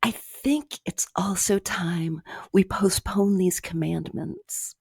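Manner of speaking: slow, hushed and half-whispered; imitated sleep talk. Imitating her own recorded sleep talk, slow and hushed. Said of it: "it's something along the lines of".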